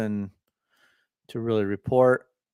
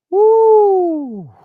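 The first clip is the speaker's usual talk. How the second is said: high, loud whoop of excitement; excited whoop; 12 dB louder than usual. Real, not acted. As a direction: let out a big celebratory woo. Sudden excited cheer.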